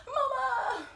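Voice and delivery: very high, drawn-out wail for mom; calling out. Acted. Daughter banging on the door for her mother, wailing call. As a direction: call through a door in a high, pleading cry.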